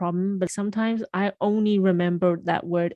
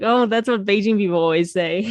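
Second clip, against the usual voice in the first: louder, mildly smug Beijing-speaker voice; a light send-up of a proud Beijinger; imitating Beijing people; 2 semitones higher, 7 dB louder than usual. Mocking how Beijing people talk to friends, louder and mildly smug. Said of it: "it reminds me, like".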